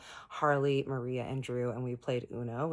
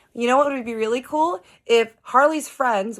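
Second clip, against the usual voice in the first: retold sarcastic comeback; 10 semitones higher, 12 dB louder than usual. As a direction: throw his word back in mock enthusiasm.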